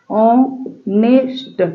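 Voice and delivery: Honest very slowly in separate pieces; syllable by syllable. Shown as a demonstration. Teacher shows learners how, honest slow and split. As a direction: break honest into ऑ, ने, स्ट. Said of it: "देखिए कैसे".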